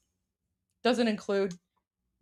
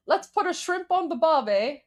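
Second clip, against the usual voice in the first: higher-pitched mock Australian accent; Jim Carrey; 6 semitones higher than usual. Streamer quoting Dumb and Dumber to viewers, mock Australian accent. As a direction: quote the film line in a playful Aussie accent. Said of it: "That's from Dumb and Dumber".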